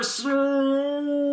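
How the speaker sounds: drawn-out slow-motion voice; slowed down deep. Pretend. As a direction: stretch every word like slow motion. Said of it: "my very realistic slow motion impression".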